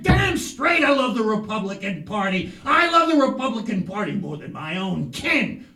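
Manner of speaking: loud, high fervent shout; hammed-up indignant loyalty; emphatic declaration. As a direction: proclaim it fervently at full volume. Defends party loyalty to Carl.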